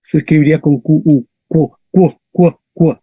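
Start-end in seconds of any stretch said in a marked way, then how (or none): 1.5-3.0 "cu" as "kw", repeated in separate bursts; Latin QU. Shown as a demonstration. Teacher drills the QU sound, kw. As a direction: drill "cu" with the w glide. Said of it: "en latín esto se pronuncia Q".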